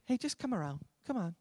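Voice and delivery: casual, softened, take it or leave it; casual invitation. Shown as a demonstration. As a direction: toss it off quietly, no urgency. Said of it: "He's not just saying".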